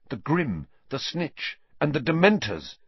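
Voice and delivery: plain reading voice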